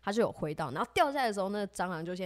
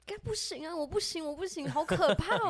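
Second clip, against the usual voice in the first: much higher, squealing refusal; imitating scared whine; 8 semitones higher than usual. Friend refusing to swat the cockroach, high scared whine. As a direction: play the friend too scared to try.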